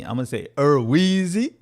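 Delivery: Erweezy sung high and loud with a rising lilt; sing-song Erweezy; playful name. Shown as a demonstration. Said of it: "That's the only way I'm gonna say your name".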